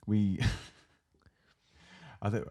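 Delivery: breathy giggle before a cheeky word; hushed laugh before naughty word; giggling whisper. Real, not acted.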